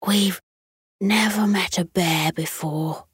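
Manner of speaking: whispered little stoat; awed whisper. Acted. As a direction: breathe it in wonder.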